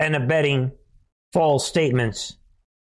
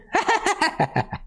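squealing high giggle; high laugh. Real, not acted. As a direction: break into a shrill laugh. Laughing at own impression.

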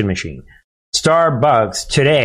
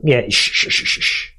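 shushing. Pretend. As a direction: hush them like a secret. Mock hushing about quiet order.

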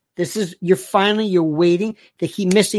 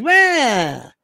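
sneering bully; 4 semitones higher, 4 dB louder than usual. Retold or imitated. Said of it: "So he says".

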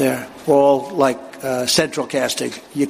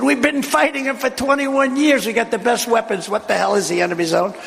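incredulous protest; 7 semitones higher than usual. Retold or imitated. President's retold protest to crew.

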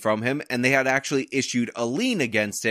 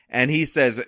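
usual speaking voice

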